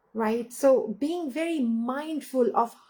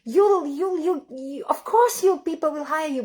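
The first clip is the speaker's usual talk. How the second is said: higher and louder, rushing to reassure her; mother jumping in to reassure her daughter, raised and urgent; urgent reassurance; 5 semitones higher, 4 dB louder than usual. Retold or imitated. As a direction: blurt out that she's wrong, eager to comfort. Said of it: "just wanted to say".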